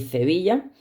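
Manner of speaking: "Sevilla" con z inicial: "Zevilla"; ceceo andaluz. Shown as a demonstration. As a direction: di "Sevilla" ceceando, la s como z. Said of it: "el seseo y el ceceo". ("Sevilla" con ceceo, empieza como "ce")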